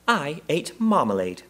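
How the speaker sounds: high, slow little bear voice; rather proud. A narrator's reading. Bear showing its empty jar, rather proud. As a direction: announce it slowly with mild pride. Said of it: "he said rather proudly".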